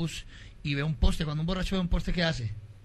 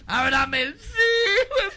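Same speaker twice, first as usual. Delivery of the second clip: put-on higher, louder drunken plea; drunken shouting; 12 semitones higher, 5 dB louder, much slower than usual. Drunk shouts at his wife to open the door, loud and sloppy. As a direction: holler at the door, slurring.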